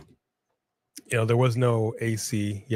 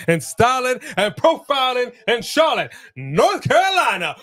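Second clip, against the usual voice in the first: high, hyped-up wrestling holler; showman's catchphrase; 10 semitones higher, 4 dB louder than usual. Acted. Host hyping a viewer's North Carolina city, high showman holler. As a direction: belt it out, capped with a whoop.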